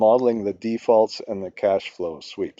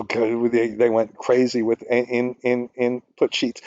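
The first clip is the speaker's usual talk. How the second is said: normal talking voice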